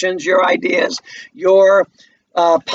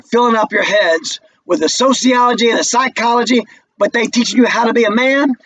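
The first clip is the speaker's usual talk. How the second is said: higher, heated mocking voice; bitter young man jeering at a college boy; Walter taunting; 4 semitones higher than usual. Teacher reads Walter's part.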